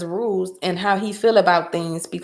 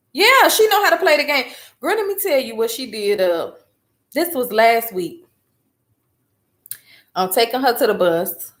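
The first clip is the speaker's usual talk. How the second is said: usual speaking voice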